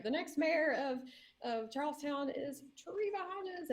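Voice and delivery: high, drawn-out proclamation; retold announcement. Friend proclaiming the new mayor, imitated announcement.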